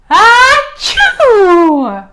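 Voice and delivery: loud, high hammed-up "Achoo!"; a huge bear sneeze; big sneeze.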